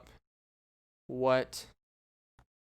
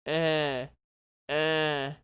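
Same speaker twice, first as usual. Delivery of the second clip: short droning mummy "eh"; mummy groan imitation. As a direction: imitate the mummy's toneless bleat. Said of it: "you heard the little eh".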